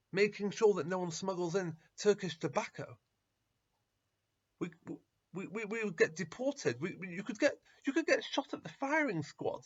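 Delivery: loud, high, stammering alarm; alarmed protest. Acted. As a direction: panic at the danger, voice climbing. Disciples panic about smuggling danger.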